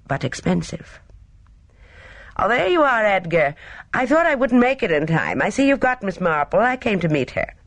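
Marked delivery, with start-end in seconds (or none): 2.4-7.5 put-on higher, lively young woman's greeting; breezy young Gina. A narrator's reading. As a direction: greet them brightly and confidently.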